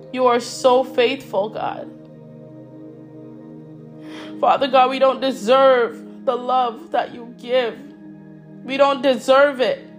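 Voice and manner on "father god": normal talking voice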